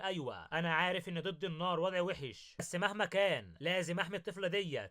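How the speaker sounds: ordinary delivery, nothing marked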